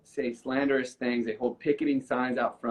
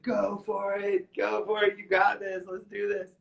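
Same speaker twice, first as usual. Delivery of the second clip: excited cheering; 8 semitones higher than usual. Retold or imitated. Dreamer cheering on God's chiseling hands, high and excited.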